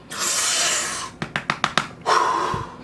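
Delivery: slow, exaggerated breath in and out; long deep breath. Viewer follows the advice to breathe, long deep breath.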